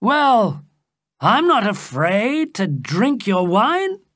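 high, bold declaration; defiant cry. A narrator's reading. The king takes up Duke Michael's wine, defiant cry. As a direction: declare it boldly, pitched well up. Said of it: "the king cried".

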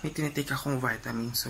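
soft whisper so nobody wakes; whisper, someone's sleeping nearby. Real, not acted. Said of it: "medyo pa bebe yung boses natin".

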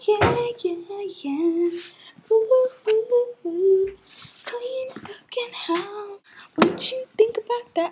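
high, teasing voice; high squeaky brag. Pretend. High-pitched bragging.

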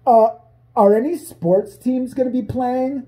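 slow, high dopey question; dumb dude. Retold or imitated. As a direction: drawl it out like a clueless guy. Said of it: "one of the dudes asks".

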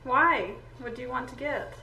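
ordinary talk, nothing marked

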